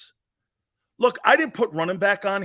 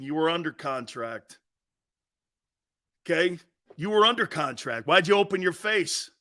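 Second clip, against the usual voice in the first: normal talking voice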